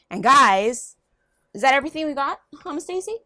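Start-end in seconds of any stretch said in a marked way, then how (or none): 2.6-3.2 calling the bird. Real, not acted. Calling to her pet bird.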